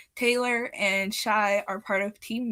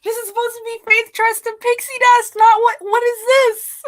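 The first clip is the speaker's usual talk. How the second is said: mock outrage; 13 semitones higher, 11 dB louder than usual. Ranting about a children's movie.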